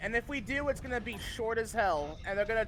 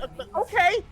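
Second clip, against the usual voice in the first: confused okay; 10 semitones higher, 8 dB louder than usual. Retold or imitated. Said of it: "And he's like".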